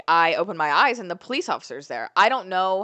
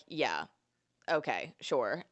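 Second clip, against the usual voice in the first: unenthused; 6 semitones lower, 8 dB quieter, slower than usual. Retold or imitated. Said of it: "And I was like".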